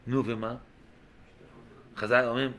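usual speaking voice

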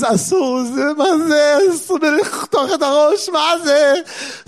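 high, slow outraged Israeli cry; indignant Israeli son. Retold or imitated. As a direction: wail the Hebrew in Israeli outrage. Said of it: "but he's Israeli, you know".

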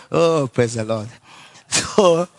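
ordinary talk

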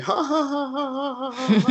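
high, helpless demo of hysterical laughing; hysterical laughter. Shown as a demonstration. Showing how his prayer turned into wild laughing. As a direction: cackle uncontrollably, way up high. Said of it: "hysterically laughing".

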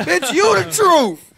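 high, loud, drawn-out holler; hollered praise. Retold or imitated. Comic hollering at a passing woman in his story, hammed-up praise. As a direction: holler it slowly in hammed-up awe. Shouts admiration while joking, laughing.

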